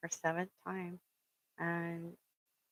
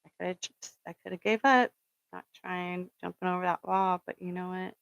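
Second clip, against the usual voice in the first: ordinary delivery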